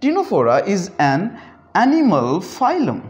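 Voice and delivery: slow, careful delivery of Ctenophora is an animal phylum; slow teaching read. Shown as a demonstration. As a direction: spell it out slowly for the class. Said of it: "हम सी को खामोश रखेंगे". (धीरे धीरे समझाते हुए)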